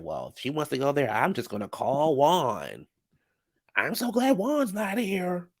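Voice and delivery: lightly voiced castmate, slow and drawn out, climbing high; imitating a castmate. Recapper voices Roberto deciding to call Juan, drawn-out imitation. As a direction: stretch the words in a put-on lilt.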